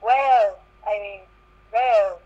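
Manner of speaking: normal talking voice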